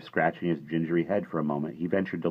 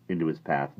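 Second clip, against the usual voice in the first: plain reading voice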